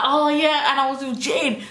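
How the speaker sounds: high sheepish excuse; nervous excuse. Retold or imitated. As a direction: stammer out a lie. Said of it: "I was like".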